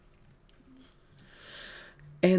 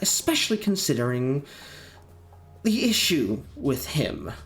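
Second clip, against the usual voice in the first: put-on higher, measured older woman voice; 6 semitones higher than usual. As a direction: play a firm older woman. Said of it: "the older woman says".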